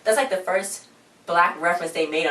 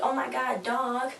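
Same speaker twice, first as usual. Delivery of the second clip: mock surprise; 7 semitones higher, 3 dB quieter, slower than usual. Acting out Casey finding the dog.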